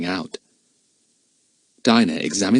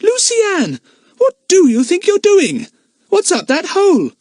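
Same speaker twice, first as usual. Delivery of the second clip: loud, high, scolding shout; yelling; 6 dB louder than usual. A narrator's reading. Dinah yelling at Lucy Ann's feet in the hole. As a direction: yell at her through the rock. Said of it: "yelled at her".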